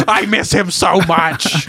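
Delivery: melodramatic character. Acted. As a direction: draw it out in hammed-up sorrow. Continues the melodramatic character bit.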